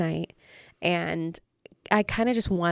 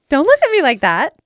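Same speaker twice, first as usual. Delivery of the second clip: playful loud protest; 7 semitones higher, 10 dB louder than usual. Real, not acted. Mock-offended plea to friend.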